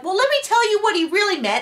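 high, prissy explaining voice; mocking Robin. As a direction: parody her smoothing things over. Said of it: "Robin's like".

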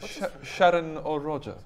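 over-polite, put-on posh accent; nervous posh. Son asks after Sharon and Roger, awkwardly posh. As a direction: enquire formally in a posh voice. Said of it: "[name] goes posh when".

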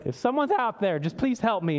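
mock lost cry into the void; vague desperate plea. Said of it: "We're just like".

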